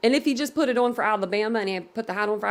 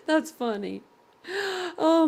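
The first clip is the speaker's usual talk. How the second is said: ordinary delivery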